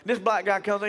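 normal talking voice

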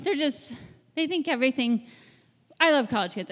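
ordinary delivery

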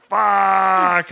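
high, strained hammed-up curse; mock squeaked curse. MC on stage cursing himself, high squeak. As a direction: blurt it in high dismay. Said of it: "I went".

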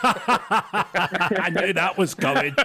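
slow, laughing knowing line. Real, not acted. Co-host laughing as the host asks about an agent. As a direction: laugh it out like you saw it coming.

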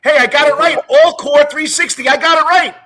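loud, high-pitched crowing; excited shout. Real, not acted. Host crowing to his guest over the name, excited shout. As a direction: shout it out in triumph.

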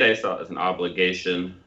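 ordinary delivery

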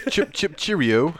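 halting mock British cheerio; slow, unsure stab at British; British accent attempt. Shown as a demonstration. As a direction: try out a hesitant, cartoonish British accent. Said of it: "how would a British accent go".

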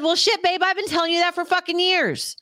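finally vindicated, throw it at him; exasperated told-you-so. Retold or imitated.

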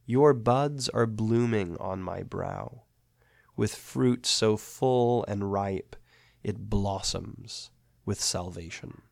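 slow, measured poetry reading; solemn recitation of an ode; poem reading. Acted. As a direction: read it like a hymn, unhurried. Reads the ode aloud.

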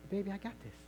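hushed fatherly calm; soft reassurance. Acted. Father reassuring his eager daughter, softly. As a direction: settle the child quietly. Father calmly answers protesting child.

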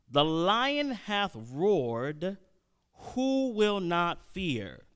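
very slow, drawn-out verse; dramatic scripture reading. Acted. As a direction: stretch each word of the verse for weight.

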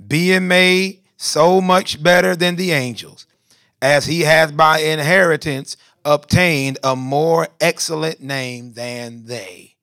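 loud, slow, high declaimed scripture; leading unison reading. Real, not acted. Preacher leads the congregation reading verse four, loud measured recitation. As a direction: call the verse out slowly so everyone can join.

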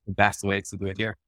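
normal talking voice

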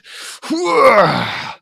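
loud, high straining heave; exaggerated exhale of effort; effort noise. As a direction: push out a big mock exertion sound. Straining to crank the arrow.

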